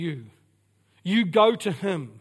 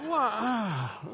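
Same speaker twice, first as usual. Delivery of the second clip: baffled reaction; 3 semitones higher than usual. Retold or imitated. Acting baffled reaction to emoji.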